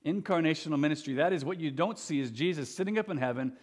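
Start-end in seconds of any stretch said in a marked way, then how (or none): none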